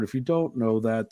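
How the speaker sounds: normal talking voice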